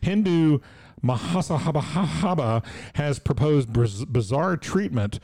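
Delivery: put-on slow, lightly Indian-accented headline read. Host reads news headline in a light Indian accent. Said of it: "I don't do an Indian accent".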